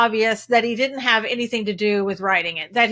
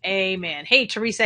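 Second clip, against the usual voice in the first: ordinary delivery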